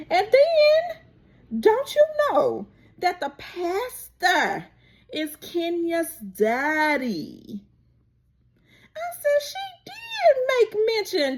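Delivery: real thrilled gasp of news; excited shock. Real, not acted. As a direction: squeal it out in disbelief. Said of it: "excited realization".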